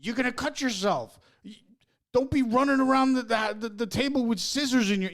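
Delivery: high, loud parental yelling; exasperated parent shouting at a child; yelling at kids. Retold or imitated. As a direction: yell it like catching a kid with scissors. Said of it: "when I yell at my kids".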